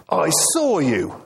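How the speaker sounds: slow, high-pitched accusation; angry accusation. Acted. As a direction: catch the culprit out, drawing the words out. Van Cleef accuses a thief.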